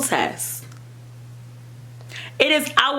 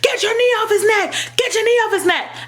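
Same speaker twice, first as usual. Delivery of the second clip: urgent bystanders' shout, much higher; crowd shouting; 13 semitones higher than usual. Retold or imitated. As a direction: yell it like the crowd at the scene. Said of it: "everybody was like".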